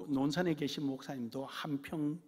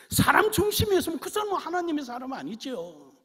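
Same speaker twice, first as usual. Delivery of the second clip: loud, much higher sermon climax; preaching at full force; 5 semitones higher, 11 dB louder than usual. Acted. Pastor urging his church to be God's people, loud fervent preaching. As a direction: proclaim it to the whole hall.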